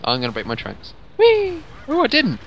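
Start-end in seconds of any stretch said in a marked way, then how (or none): 1.2-2.4 high, loud squeal of delight; gleeful whee. Real, not acted. Racing his friend downhill, squealing with glee.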